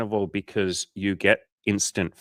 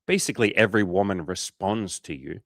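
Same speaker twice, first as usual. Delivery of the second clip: normal talking voice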